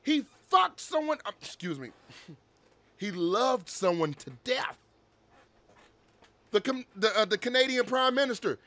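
ordinary talk

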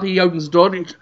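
normal talking voice

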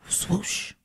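breathy soft sound effect; whispered swoosh. Acted. Reader voicing the green light, whispered whoosh. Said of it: "green light comes".

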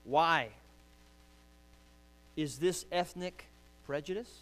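ordinary talk

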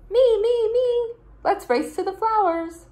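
very high, loud eager shouting; bursting to play; excited shout. Acted. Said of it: "shouted Snippet".

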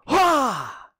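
high, loud karate shout; kung fu cry. Acted. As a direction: yell like a kung fu panda striking.

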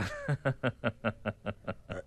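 a soft, falling chuckle; chuckle, pleased with the joke; amused chuckle. Acted. The caliph chuckles softly to Masroor about Abul Hasan.

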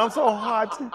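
high, loud mock complaint; mocking whiny voice. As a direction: say the clean version instead. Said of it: "Can't say horny".

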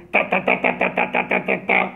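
low, loud rat-a-tat gunfire sounds; imitated gunfire. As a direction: rattle it out like a machine gun. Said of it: "הערט מען אזא מין שיסעריי". (נאכגעמאכטע שיסעריי)

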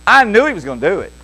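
ordinary delivery

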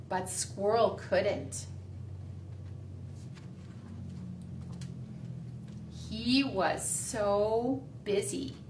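slow storybook reading; reading to children. A narrator's reading. Teacher reading The Busy Little Squirrel to the class, slow. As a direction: read it slowly to little kids.

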